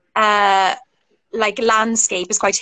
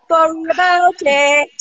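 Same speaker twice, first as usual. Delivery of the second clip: mock apology; 6 semitones higher, 3 dB louder than usual. Guest apologising for showing off accents, mock apology. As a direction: stretch out a jokey apology. Playful drawn-out apology after confession.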